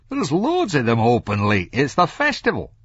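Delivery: high, loud surly cabbie; surly cabbie's incredulous protest; surly driver. A narrator's reading. Taxi driver to Franklin about late bars, surly.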